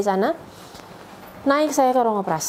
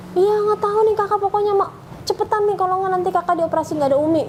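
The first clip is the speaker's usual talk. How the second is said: much higher, imitating a distressed child; retold tearful pleading; 11 semitones higher than usual. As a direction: plead urgently, like a crying child on the phone. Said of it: "Dianya juga nangis". (menirukan anak memohon sambil menangis)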